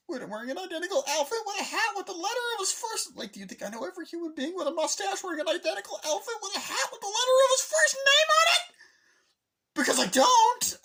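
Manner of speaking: high, loud, fast hysterical rant; frantic rant. Retold or imitated. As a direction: babble it out in a desperate panic.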